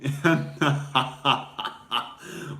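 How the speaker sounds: mock Russian villain's gloating laugh; hammed-up laugh of a winning spy; villainous laugh. Pretend Russian agent gloating over winning, villainous laugh.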